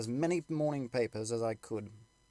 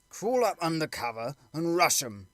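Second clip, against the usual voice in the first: eager soldier; 5 semitones higher, 5 dB louder than usual. Acted. Soldiers arguing among themselves.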